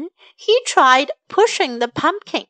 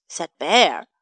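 ordinary delivery

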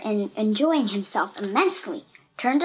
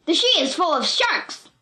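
furious yell; 6 semitones higher, 3 dB louder than usual. A narrator's reading. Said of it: "he screamed".

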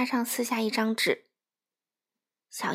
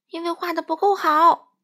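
much higher and louder furious cry; huffy shouted refusal; angry shout; 8 semitones higher, 8 dB louder than usual. Acted. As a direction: yell it in a huff. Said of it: "佛洛格气呼呼地叫道".

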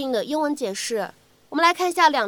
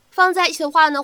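normal talking voice

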